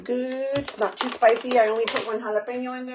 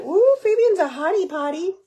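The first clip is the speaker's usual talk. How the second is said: higher and louder, a teasing mock swoon; swooning girl; 9 semitones higher, 7 dB louder than usual. Mom teasing her son by imitating a girl crushing on him, mock swoon. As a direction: gush like a girl with a crush, hammed up. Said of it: "She's gonna be like".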